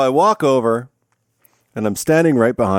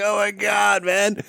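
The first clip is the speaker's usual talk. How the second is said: high, mocking groan; groaning class; 10 semitones higher, 3 dB quieter than usual. Teacher imitates students hearing the harsh tone, high mock groan. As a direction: play the suffering class. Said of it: "they're like".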